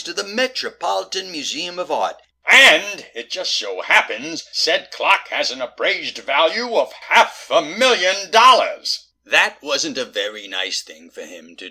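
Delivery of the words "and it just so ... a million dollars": slow, loud, mounting emphasis; pointed storytelling. Acted. Detective revealing the clock's value, slow and pointed. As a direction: spell out every word for the culprits.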